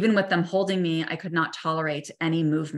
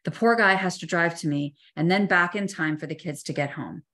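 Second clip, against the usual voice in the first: usual speaking voice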